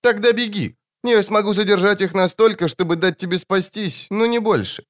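put-on high childlike urging; child-voiced demon. A narrator's reading. Demon sends the wizard away to safety, child voice. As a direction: urge escape as an eerie child creature. Doomed demon urges mage to flee.

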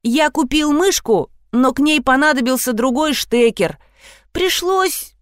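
high, hesitant confession; pleading boy. A narrator's reading. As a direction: own up like a wheedling child. (виноватый мальчик, высоким голосом)

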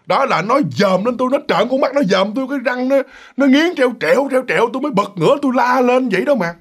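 high, urgent wife describing the horror; alarmed retelling. Retold or imitated. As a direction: relive the fright as you argue. Vợ kể cái đầu trợn mắt. (giọng vợ kinh hãi kể lại)

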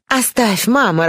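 high-pitched, haughty daughter's dismissal. A narrator's reading. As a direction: brush mother off with lofty disdain. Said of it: "раздался надменный голос Бланш". (надменным высоким голосом)

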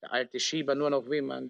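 normal talking voice

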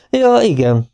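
magasról induló, hanyag igen; felolvasott, könnyed odavetés; könnyed hang. A narrator's reading. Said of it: "mondta Siegfried könnyedén". (mintha csak most jutna eszébe)

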